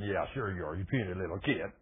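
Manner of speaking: low, soft scornful sneer; sneering Goliath. Acted. Goliath scoffing at David, low scornful sneer. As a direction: dismiss the boy with contempt.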